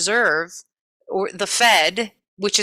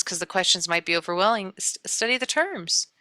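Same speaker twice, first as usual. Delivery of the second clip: ordinary talk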